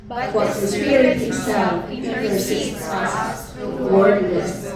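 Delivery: slow, loud group scripture reading; reading together. Real, not acted. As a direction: read it aloud in unison with the congregation. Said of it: "Let us read all together".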